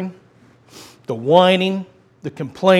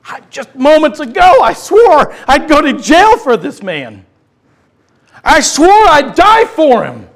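Peter's anguish; 8 semitones higher, 8 dB louder than usual. Acted. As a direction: cry out the broken promise.

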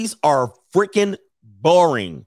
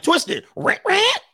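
high mock toy voice; 11 semitones higher, slower than usual. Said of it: "You be like".

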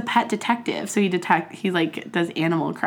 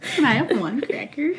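Evan impression; 4 semitones higher than usual. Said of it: "that was a quote straight out of our friend Evan's mouth".

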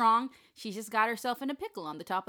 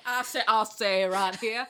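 Foghorn Leghorn; 7 dB louder than usual. Acted. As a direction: boom it like a cartoon rooster. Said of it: "Or a little bit like Foghorn Leghorn".

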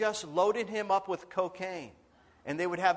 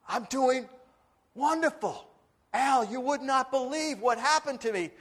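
higher, eager voice pouring out his news; overjoyed Dennis; 6 semitones higher, 4 dB louder than usual. Retold or imitated. Dying man tells his pastor of rescue, higher and eager. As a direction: relive the night with breathless joy.

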